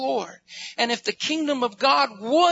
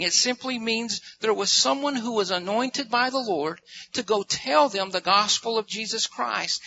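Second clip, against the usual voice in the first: ordinary talk, nothing marked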